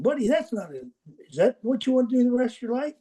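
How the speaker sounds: can't believe he settled; mock disbelief at his choice; incredulous. Coach scolding player, retold.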